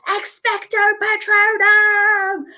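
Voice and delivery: high, slow, sung-out dramatic incantation; hammed-up heroic Patronus cry; dramatic spell. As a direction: cast it like fighting off Death Eaters. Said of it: "And it's very dramatic".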